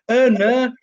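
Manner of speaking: Hull "Uh, no", both vowels flattened to "er"; imitated Hull accent. Mum quoting her son's slip, imitated Hull accent. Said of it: "Like, with the ers and the ner".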